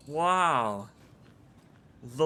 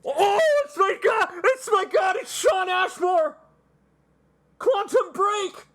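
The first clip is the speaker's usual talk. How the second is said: high, loud fan scream; excited shout; 14 semitones higher, 5 dB louder than usual. Real, not acted. Streamer spots a favourite actor in game, excited shout. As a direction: shriek with joyful recognition.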